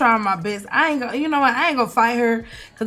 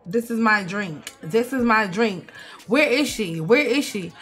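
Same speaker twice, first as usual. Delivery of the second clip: normal talking voice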